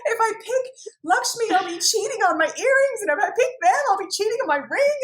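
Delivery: high, loud, playful whine; mock dilemma. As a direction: whine it like an impossible choice.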